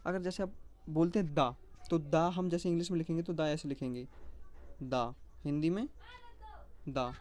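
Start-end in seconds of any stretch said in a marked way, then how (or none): none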